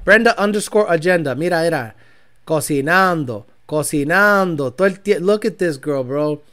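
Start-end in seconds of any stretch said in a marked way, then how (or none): none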